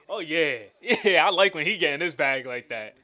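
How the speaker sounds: loud, laughing delight; real laughing excitement; laughing hype. Real, not acted. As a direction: cheer him on through laughter.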